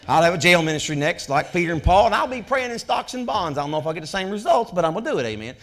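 loud, high, driving preacher's cry; fiery preaching. Acted. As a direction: preach it to the back pews at full force. Preaching at full force.

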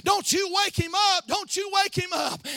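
put-on child's plea shouted from the pulpit; climactic shout. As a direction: ring it out to the congregation.

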